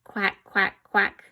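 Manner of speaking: loud quacking; loud playful duck quacks. Shown as a demonstration. Animal sound quiz for kids.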